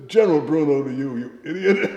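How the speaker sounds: high, very loud indignant correction; retold blustering put-down; mock rebuke. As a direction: pull rank loudly for comic effect.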